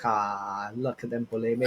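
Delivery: low, soft aside to himself; re-enacted quiet thought; muted inner remark. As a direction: say it low and under your breath, as a private thought. Inner reaction to being bullied.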